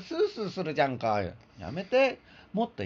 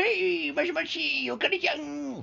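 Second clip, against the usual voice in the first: pretend phone call; 11 semitones higher, slower than usual.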